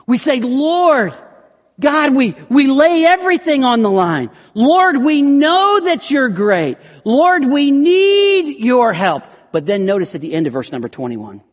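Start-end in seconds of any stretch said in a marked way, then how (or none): 0.4-9.2 high, loud, slow desperate plea; passionate prayer. Acted. Believers praying to God with desperation and passion, loud. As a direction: lay everything on the line in prayer. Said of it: "desperation and passion".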